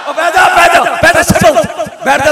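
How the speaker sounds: sharp call to sit. Real, not acted. Preacher scolds restless audience.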